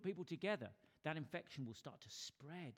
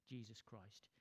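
ordinary delivery

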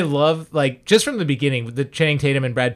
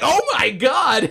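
much higher, slow laughing shout; a startled gasp at the blood; high drawn-out shocked cry; mock shock; 9 semitones higher, slower than usual. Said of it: "and you're like".

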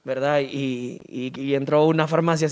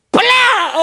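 imitated slap; 5 dB louder than usual.

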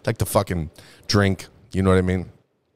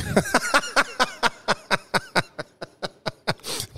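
high laugh; 4 dB quieter than usual. Real, not acted. Laughing at own joke.

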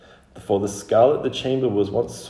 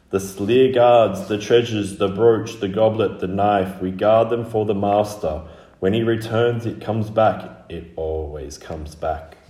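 loud guardian litany, softening at the end; old, dry voice; 5 dB louder than usual. Acted. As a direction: intone each item like an ancient oath. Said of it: "very old and very dry".

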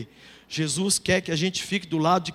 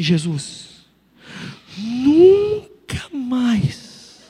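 slow, drawn-out tearful cry, louder; mock-weeping protest, dragged out; crying plea; 2 semitones higher, 5 dB louder, much slower than usual. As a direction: wail it out as if begging. Pleading protest to Jesus.

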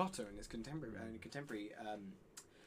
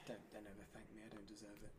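much softer mock-humble mumble; mock modesty; 3 semitones lower, 15 dB quieter, faster than usual. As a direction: fake humility, dropped way down in volume. Mock self-deprecation.

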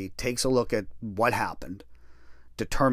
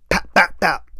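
sharp louder pap pap pap; imitated kick sounds; 4 semitones higher, 5 dB louder than usual. Retelling kicking the cellmate's nose, sharp louder pap sounds. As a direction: punch out each tap like an impact.

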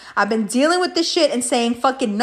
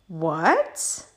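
low, soft, rising disbelief; mock shock; 8 semitones lower, 6 dB quieter than usual. Girls on learning who he is, stunned mock disbelief. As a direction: act out a stunned question, pitched down. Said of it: "All the girls turn and look".

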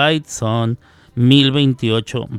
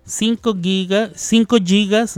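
higher-pitched imitation of a phone customer; usuario de teléfono; 7 semitones higher than usual. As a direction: mimic a user talking up their plan. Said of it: "Entonces, si usted dice". (imitando a un usuario, voz más aguda)

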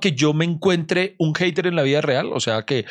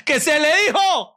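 mock exasperation; 13 semitones higher, slower than usual. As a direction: exclaim in playful frustration. (exasperación fingida, aguda y lenta)

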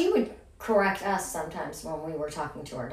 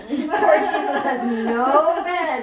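laughing; 6 semitones higher, 6 dB louder, much slower than usual. Real, not acted. Laughing joke about empty manger.

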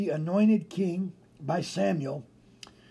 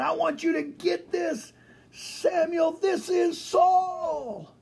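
high, slow, drawn-out proclaiming shout; God yelling; 10 semitones higher, slower than usual. Pretend. Preacher acting God presenting Saul to Samuel, yelling. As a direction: shout it out like an announcement. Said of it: "yelling, exclamation point".

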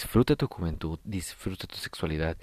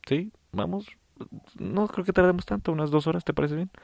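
eager partner voice; 11 semitones higher than usual. Pretend. Acted hookup chat, other person.

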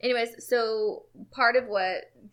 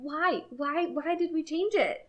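exasperated why; 9 semitones higher, slower than usual. Real, not acted. Exasperated about the movie's accent choice.

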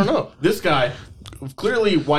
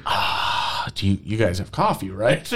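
lightly voiced old man; 9 semitones lower, 3 dB quieter than usual. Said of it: "He's like".

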